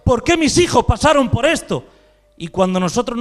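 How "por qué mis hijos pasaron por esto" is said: raised, insistent voice of reproach; protesting complaint. Acted. Believer demanding answers from the Lord, raised complaining protest. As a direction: cry out an acted complaint to heaven. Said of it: "nos quejamos y decimos".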